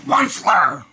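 a hoarse whispered croak of a name; croaking cry. Acted. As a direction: croak it out like a sick old creature. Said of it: "he cried with a crocholus croak".

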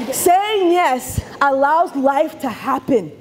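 slow, deliberate emphasis; slow sermon-like delivery; slow preachy. Real, not acted. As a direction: preach it slowly. Preachy message.

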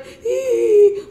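a sharp high-pitched squeal of fright. Acted. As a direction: squeal like a startled toy. Character squeals.

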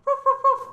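very high-pitched little dog barking; three high mock barks; imitated dog barks. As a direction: yap loudly like a small dog. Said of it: "he was barking the whole time".

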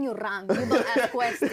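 laughing through the words; giggling as the rule is spelled out. Real, not acted. Telling friends how it was done, laughing.

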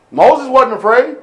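normal talking voice

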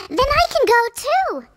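high, fast and bright with hope; eager hope. A narrator's reading. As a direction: blurt it out, hoping hard. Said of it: "said Cinderella".

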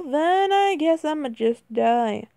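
ordinary talk

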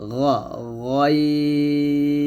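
গইন said as গইন, not গাইন; a throaty scraped গইন; Arabic ghain. Shown as a demonstration. Teacher correcting গাইন to গইন. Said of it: "বলতে হবে".

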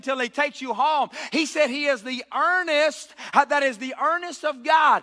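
preacher climbing to a roar; full-force preaching. Acted. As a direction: build it up and shout the verse.

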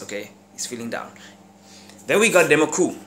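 ordinary delivery

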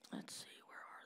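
unvoiced mumble while searching; whispered to self. Real, not acted. Preacher hunting for scriptures, whispering to himself. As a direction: whisper it to yourself. Searching notes under breath.

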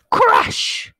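loud, high exploded crash; noisy storybook sound effect; big crash. A narrator's reading. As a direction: burst it out like a smash. Tiger crash-lands.

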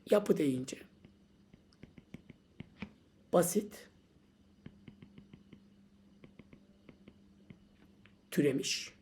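unvoiced, soft, slow; whispered list. Shown as a demonstration. Teacher whispering the answer to the student, hushed.

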